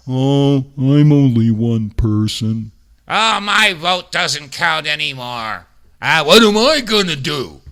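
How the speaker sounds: slow, whiny put-on voice of a defeated citizen; mocking apathetic voter. Host mocks complacent Americans who think their vote doesn't count, whiny mock voice. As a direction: mimic the helpless shrug of a complacent voter. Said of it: "this is the mentality that people have".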